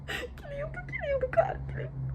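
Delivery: high, breaking sobbing cry of joy; reliving tears of joy, voice cracking. Retold or imitated. Bride reliving seeing the limousine, crying with joy. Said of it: "comecei a chorar".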